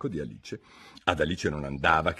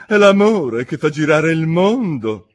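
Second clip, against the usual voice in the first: slow, louder solemn proclamation; grand moral; 8 semitones higher, 10 dB louder, slower than usual. A narrator's reading. Duchess proclaims the moral, slow and loud. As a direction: announce it like a great truth.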